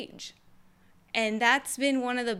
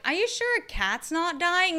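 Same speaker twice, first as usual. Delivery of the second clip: mock neighbor; 7 semitones higher, 3 dB louder than usual. Neighbor voice again in retelling.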